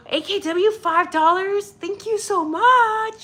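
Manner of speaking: high, loud, slow squeal of thanks; thrilled thanks. Real, not acted. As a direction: gush with delight over the gift. Excited at five dollar donation.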